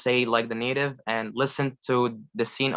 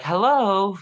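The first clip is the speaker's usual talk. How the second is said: high, louder, delighted hello; loving hello; 7 semitones higher, 5 dB louder than usual. Shown as a demonstration. Learner showing how intonation changes meaning, a loving hello. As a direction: greet someone you love and want to talk to. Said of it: "that feels like, you know, uh, I love".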